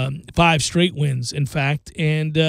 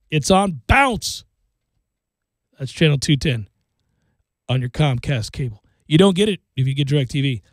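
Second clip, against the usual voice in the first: usual speaking voice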